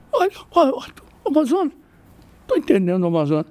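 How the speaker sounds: high, whiny, near-crying retreat; flustered backing down. Retold or imitated. Bully caught out by the new kid, high near-crying whine. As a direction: squeak it out as if about to cry, giving in.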